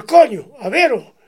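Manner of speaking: loud, emphatic retold outburst; retold exclamation. Retold piper demands to hear him. (exclamación en voz alta, imitando al gaitero)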